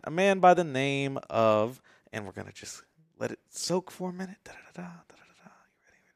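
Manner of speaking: a long hushed pause, then whispered drumroll; dramatic pauses. Shown as a demonstration. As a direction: build suspense with a whispered pause. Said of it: "I love a dramatic pause".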